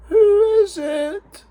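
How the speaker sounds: high, slow, quavering granny voice; old granny. Acted. Granny calls out to her door, high and quavery. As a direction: call through the door like a frail old lady. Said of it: "Granny said".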